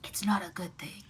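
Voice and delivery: true whisper with no voice; whispered reply. Acted. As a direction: whisper it back secretively.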